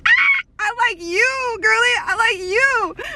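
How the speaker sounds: high, drawn-out fangirl wail; hammed-up swooning cry; fangirl squeal. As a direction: squeal it at your idol, stretched out. Mock fangirl outburst.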